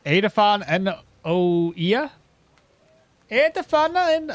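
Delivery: put-on trying out an Irish accent on fantasy names; a shaky first go at an Irish accent; attempted Irish accent. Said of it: "that might be the wrong accent".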